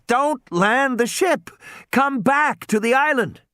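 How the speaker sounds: put-on high boy's voice, urgent; a kid pleading into the phone; boy on radio. Tim urging the boat over the radio.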